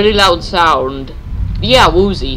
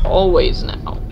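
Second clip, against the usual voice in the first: usual speaking voice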